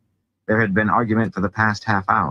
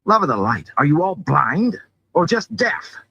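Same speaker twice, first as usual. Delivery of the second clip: high-pitched, heated rhetorical questions; incredulous, rising exasperation; exasperated outburst; 11 semitones higher, 3 dB louder than usual. A narrator's reading. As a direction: burst out in disbelief at their stubbornness.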